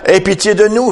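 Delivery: high-pitched appeal for mercy; preacher's imitation of a begging cry; acted plea. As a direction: voice the blind men's plea, pitched well up. Blind men's plea voiced once more.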